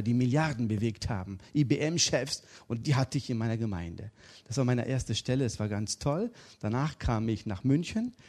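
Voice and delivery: normal talking voice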